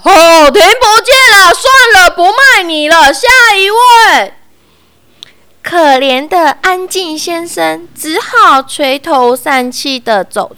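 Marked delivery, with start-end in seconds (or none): 0.0-4.3 high, loud furious dismissal; angry roar. A narrator's reading. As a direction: bellow him out of the line. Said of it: "店员气得大吼".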